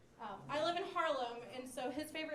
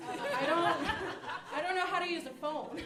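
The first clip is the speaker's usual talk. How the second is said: higher, louder pleading excuse to dad; exasperated kid; 4 semitones higher, 8 dB louder than usual. Retold or imitated. Seven-year-old answering angry dad, loud exasperated excuse. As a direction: protest it to dad, loud and flustered. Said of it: "I was like, Sorry, Dad".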